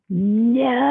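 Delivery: shouted no; loud no. Acted. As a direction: bark the no at the duck. Pigeon shouts no.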